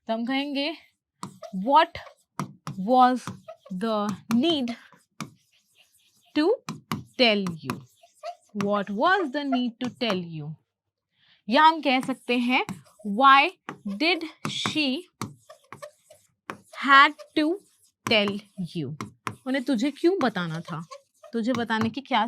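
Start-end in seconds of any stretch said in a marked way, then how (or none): 1.6-7.7 slow dictation, long gaps between words. Shown as a demonstration. Teacher translating the line for learners, word by word. 13.1-19.1 slow dictation in separated chunks; word by word. Shown as a demonstration. Teacher offering another translation, word by word.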